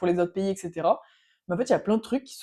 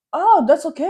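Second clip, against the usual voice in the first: high, loud put-on acceptance; mock naive agreement; 9 semitones higher, 9 dB louder, slower than usual. Young traveller avoids debate with German friend, mock high-pitched agreement. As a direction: chirp agreement, sending up your younger self. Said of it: "jeune et, et con, je fais".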